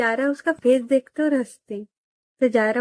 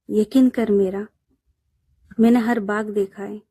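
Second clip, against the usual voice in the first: shayari recitation; 3 dB louder than usual. Acted. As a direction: recite the couplet with feeling, savouring each line. (धीमी, भावपूर्ण शायरी पढ़ना)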